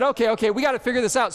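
quick, run-together acted quote; hurried, get-down-to-business delivery; brisk resolve. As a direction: rattle it off like someone taking charge. Voices researcher resolving to act.

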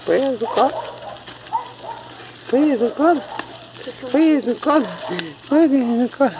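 high-pitched cutesy begging. Real, not acted. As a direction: beg sweetly in a tiny high voice. Baby-talk pleading for the puppies. (pedindo com voz fininha de bebê)

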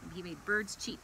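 put-on gentle storybook read-aloud for a child; animated children's Bible story voice. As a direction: tell it like a bedtime story.

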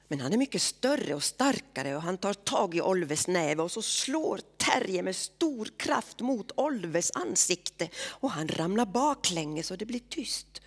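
plain reading voice

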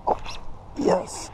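normal talking voice

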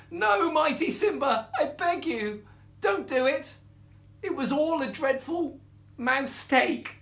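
high, loud, panicky begging; pleading mouse. A narrator's reading. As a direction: beg for your life as a tiny mouse. Mouse begs lioness for its life.

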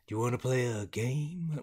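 a hint of a creepy puppet villain; Jigsaw voice. To another player in the game, a lightly done Jigsaw voice. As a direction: try out the horror-movie game master's line. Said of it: "how do you do his name? ... his voice".

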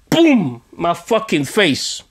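ordinary delivery

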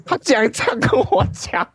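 talking through high laughter; laughing. Real, not acted. Laughing in disbelief while retelling the landlord's reply.

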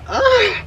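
high disgusted groan. Real, not acted. Disgust in shower.

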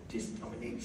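very low, very soft mutter; trailing off almost to nothing; low, faint, sinking voice; barely audible. Retold or imitated. Retold inner talk, fading.

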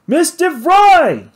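long high shout into the jungle. Acted. Bruce calling for the missing lady into the wilderness, loud drawn-out shout. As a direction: call her name out across the valley at full voice. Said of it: "he shouted".

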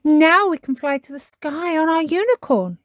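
put-on high, loud, joyful child character; cheering. A narrator's reading. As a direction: cheer it out as if taking off. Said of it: "cheered Peppa".

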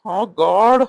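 exasperated outburst. Acted. Husband exasperated in quarrel.

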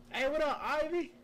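high, loud hey to a viewer; loud greeting. Real, not acted. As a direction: call the name out, high and loud.

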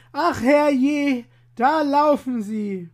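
high, loud startled cry; baker's cry. Acted. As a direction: yell it out as the shocked baker. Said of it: "der Meister Bäcker schrie".

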